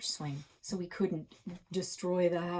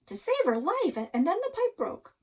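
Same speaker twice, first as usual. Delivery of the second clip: excited blurt; 12 semitones higher, 8 dB louder than usual. A narrator's reading. Narrator blurting the rescue.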